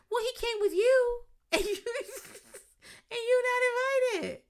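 very high, loud and slowing, laughing through it; mocking high laughing voice. Cast member jabbing Lila about Brian, loud high laughing mockery. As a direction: squeal it out with giggles, dragging the last words.

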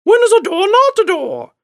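very high, loud crone screech; hammed-up eerie crone voice; impatient old crone. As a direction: repeat the riddle as an impatient hag. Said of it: "she impatiently repeated the same riddle".

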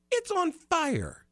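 alarmed disbelief. Retold or imitated. Realising the van burns.

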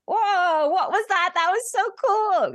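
high, awed exclamation; high amazed whoa. Retold or imitated. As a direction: gasp it out in wonder. Quoting own amazement after channeling.

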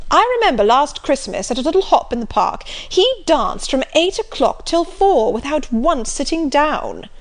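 loud, bluff country squire; jovial loud old gentleman; hearty Sir John. Acted. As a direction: boom it out like a jolly squire.

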